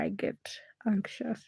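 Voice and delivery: whispered. Real, not acted. Whispered admission.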